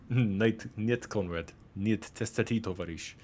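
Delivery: jokey fake Russian accent, half-hearted; mock Russian accent. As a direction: play a gruff Russian for a laugh, lightly. Mock Russian at game painting.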